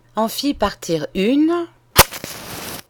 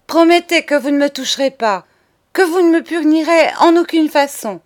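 loud, high, insistent demand; exigence pressante; 7 semitones higher, 6 dB louder than usual. Acted. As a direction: force a promise out of her. Charles demands a promise under threat. (ultimatum insistant, fort et haut perché)

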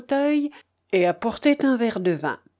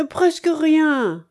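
drawn-out, high and louder answer; réponse inquiétante; 8 semitones higher, 5 dB louder, much slower than usual. A narrator's reading. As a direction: stretch PRESQUE RIEN out slowly, higher. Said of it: "répondit le sec d'un air qui jeta". (« presque rien » traînant, haut perché)

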